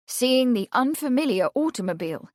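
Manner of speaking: plain reading voice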